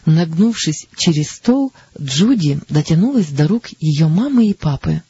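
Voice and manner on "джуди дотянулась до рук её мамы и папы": usual speaking voice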